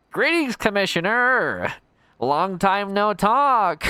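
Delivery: high, slow, theatrical villain greeting; Skeletor voice. Retold or imitated. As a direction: ham it up like a cartoon skeleton villain. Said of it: "my Puzzler sounds like Skeletor from He-Man".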